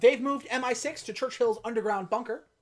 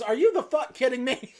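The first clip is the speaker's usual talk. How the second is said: louder, laughing disbelief; disbelieving Bond; 4 semitones higher, 6 dB louder than usual. Retold or imitated. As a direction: blurt it out laughing. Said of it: "he goes".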